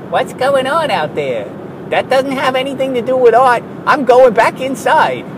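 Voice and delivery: a tiny scared artist muttering from the box; timid mouse artist. Retold or imitated. Artist peeking out of his box, small mouse voice.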